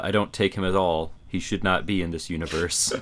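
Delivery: ordinary delivery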